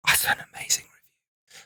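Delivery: hushed unvoiced whisper. Reading a review alone, whispered in awe. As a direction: whisper it to yourself in disbelief.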